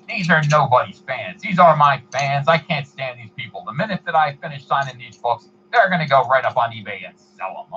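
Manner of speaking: indignant mock complaint; Stan Lee impression. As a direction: rant like a fed-up old star. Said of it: "compliment on my impression".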